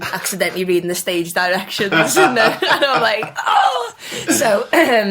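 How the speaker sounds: loud laughing recall; laughing through the story. Real, not acted. Laughing at dad's reading.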